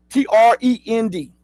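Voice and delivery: T R E N D said as separate letters, one by one; spelled out. Shown as a demonstration. Spelling a word for viewers, letter by letter. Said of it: "sometimes I spell it out for you".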